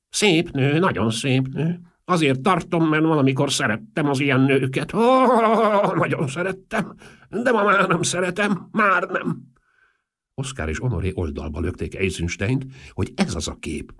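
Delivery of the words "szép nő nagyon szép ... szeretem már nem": put-on high, piping old eccentric; Marchese. A narrator's reading. Old Marchese recalling women he once liked, high eccentric voice. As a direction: reminisce in a very high old man's voice.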